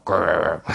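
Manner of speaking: low throaty grr; playful growl. Shown as a demonstration. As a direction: growl it like a grumpy dog. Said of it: "I just randomly growl".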